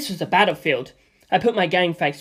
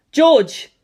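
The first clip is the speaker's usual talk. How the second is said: calling out; 8 semitones higher, 7 dB louder than usual. Acted. Lisa Lisa calls out.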